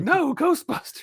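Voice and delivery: high squeaky voice; mock correction. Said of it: "you're like".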